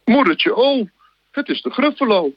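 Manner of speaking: high, alarmed voice calling out; high startled cry at the monster; scared snake cry. Acted. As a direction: squeal it in sudden fright. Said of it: "Echt fantastisch met al die stemmetjes".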